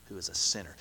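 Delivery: low, soft sneer. Retold or imitated. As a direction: sneer it with contempt. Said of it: "You can just hear the sneer in their voice".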